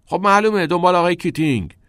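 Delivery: loud, high emphatic retort; exasperated answer. A narrator's reading. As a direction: throw out the obvious answer. Heated answer in the quarrel.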